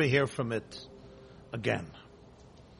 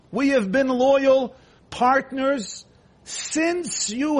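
acted indignant plea, rising higher; impassioned protest; 4 semitones higher than usual. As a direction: plead your long loyalty with mounting insistence. Donkey pleads its long loyalty.

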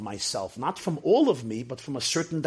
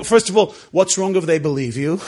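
ordinary talk

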